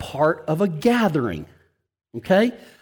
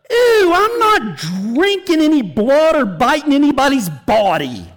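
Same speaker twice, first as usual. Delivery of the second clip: put-on high, wailing kid's "ew", dropping at the end; disgusted child; 7 semitones higher than usual. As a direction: imitate a grossed-out girl, pitched up. Child recoils at communion, retold.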